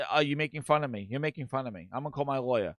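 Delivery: low, soft victim act; quiet threat. Retold or imitated. Booty threatens HR with his lawyer, quiet threat. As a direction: deliver the mock threat hushed and low.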